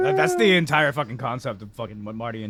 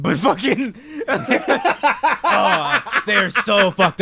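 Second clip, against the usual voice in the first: higher and louder through laughter; laughing; 9 semitones higher, 6 dB louder, much slower than usual. Real, not acted. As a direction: crack up while saying it. Describing the characters, laughing.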